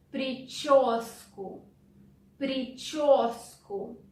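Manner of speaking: slow, careful Причёску with a clear ч in the middle. Shown as a demonstration. Teacher drilling a student, slow and careful. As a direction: stretch out Причёску so the ч stands out. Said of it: "Sound ч is difficult, pay attention to it".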